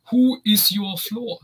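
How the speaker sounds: put-on slow, stilted German accent; imitating Connery's bad German accent, slowly; lightly voiced German accent in broken English; mock German accent. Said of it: "tries to do, like, an accent and mispronounce stuff".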